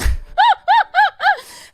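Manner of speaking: loud, very high laugh; real laugh. Real, not acted. Streamer after a swearing spree, loud high laugh. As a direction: burst into high, loud pulses.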